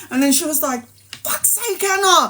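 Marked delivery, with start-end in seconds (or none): 1.2-2.2 higher, fed-up snap; mock exasperation; exasperated. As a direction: roll your eyes at her. Friend exasperated.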